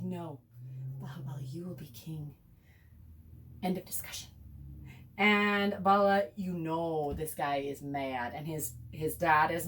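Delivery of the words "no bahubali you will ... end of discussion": the queen speaks softer, partly in a whisper; hushed decree. Retold or imitated. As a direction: settle it in a low hush. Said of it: "And she's like".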